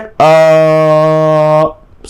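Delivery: one long unchanging "अ"; held vowel. Shown as a demonstration. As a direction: hold "अ" steady for two seconds. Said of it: "दो second तक".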